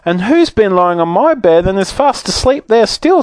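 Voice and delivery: high, squeaky little-bear voice; baby bear. A narrator's reading. Baby bear finds a sleeper in bed, squeaking. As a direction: pipe it up in a squeal. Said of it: "said the baby bear".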